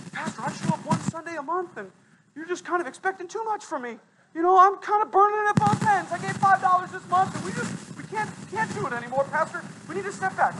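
high, whining excuse-maker; pleading congregant making excuses to the pastor, high and whiny; whiny churchgoer. Acted. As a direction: whine like a member dodging church.

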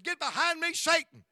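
high, loud preacher rebuke; mock rebuke. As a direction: cast her off like Satan, hammed-up. Preacher retorts in argument with wife.

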